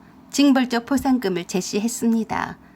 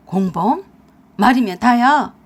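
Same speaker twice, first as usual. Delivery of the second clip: loud outraged snap; outraged retort; 6 dB louder than usual. Acted. Husband snapping back at wife, loud and outraged. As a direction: bark it back indignantly.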